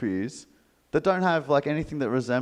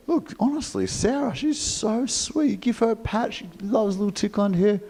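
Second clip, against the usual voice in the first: doting sanctuary lady; 9 semitones higher than usual. Retold or imitated. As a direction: coo over her favourite hen. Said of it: "she's telling me".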